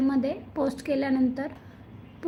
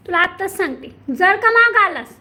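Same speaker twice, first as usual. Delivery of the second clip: louder and higher warning; mock threat; 4 semitones higher, 7 dB louder than usual. Real, not acted. Owner warns the pet not to follow her. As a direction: threaten him with a smack.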